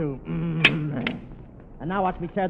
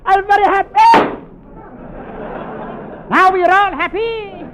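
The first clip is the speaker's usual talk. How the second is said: higher and louder with each happy; ecstatic; 12 semitones higher, 7 dB louder than usual. Acted. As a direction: build to a giddy squeal. Ecstatic after the kiss.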